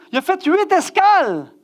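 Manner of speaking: high, slow, incredulous complaint; comic exasperation. Retold or imitated. Tired guest telling of detours to his room, comic exasperation. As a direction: stretch it out in mock outrage.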